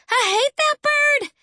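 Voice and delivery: high-pitched upset outburst; angry holler. A narrator's reading. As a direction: holler it in tearful outrage. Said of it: "she hollered".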